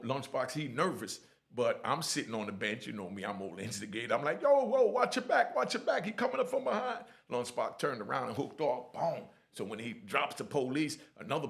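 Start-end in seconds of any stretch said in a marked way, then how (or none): 4.4-7.0 high, loud, urgent heads-up; shouted warning. Retold or imitated. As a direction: call out to warn a fighter from the sidelines. Shouting a warning to a fighting friend.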